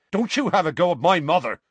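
loud, high, indignant warning; angry snap. A narrator's reading. Seamus defends his mother to Harry, snapping angrily. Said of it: "Seamus snapped".

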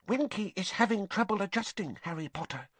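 small, hushed confiding elf; confidential squeak. A narrator's reading. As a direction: squeak it like a secret. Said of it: "squeaked Dobby confidentially".

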